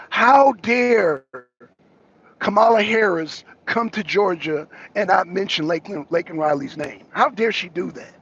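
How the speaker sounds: normal talking voice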